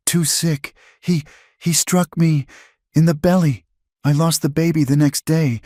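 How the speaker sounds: high, trembling voice of grief; a broken mother naming her abuser; Clara, grieving. A narrator's reading. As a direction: confess the horror through tears. Clara's tearful confession.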